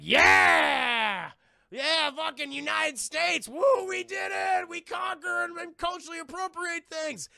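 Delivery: loud, high patriotic whooping; hammed-up victory celebration; triumphant cheering. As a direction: holler it slowly, long vowels. Acting gloating soldier's celebration.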